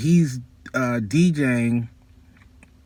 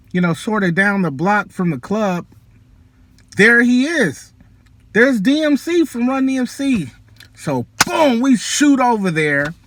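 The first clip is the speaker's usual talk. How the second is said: much higher, louder, buzzing with excitement; reliving the big moment; breathless high excited narration; thrilled storytelling; 8 semitones higher, 4 dB louder than usual. Real, not acted. Thrilled retelling of seeing [name].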